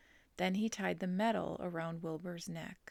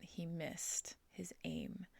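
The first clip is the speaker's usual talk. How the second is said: ordinary delivery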